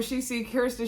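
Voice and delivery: ordinary talk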